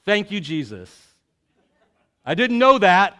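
preacher joking to the congregation: loud mock surprise. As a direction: feign amazement for the laugh. Sarcastic joke to congregation.

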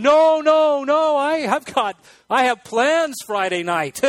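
comic refusal to be taken before Friday; mock panic. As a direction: back away from Jesus in a fake panic. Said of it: "You're going".

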